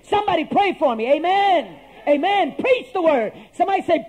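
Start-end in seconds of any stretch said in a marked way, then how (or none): none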